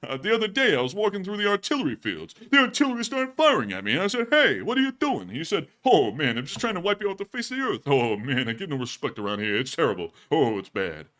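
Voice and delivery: put-on sad-sack soldier moaning about no respect; grumbling soldier voice. Soldier grumbling to viewers, mock woe-is-me comic voice. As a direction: whine it like a hard-luck comic. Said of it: "they're going".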